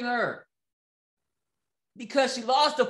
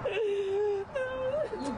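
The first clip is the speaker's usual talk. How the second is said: mock crying; 8 semitones higher, 6 dB quieter than usual. Mocking the crying mother.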